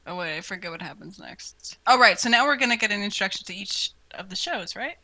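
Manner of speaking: ordinary delivery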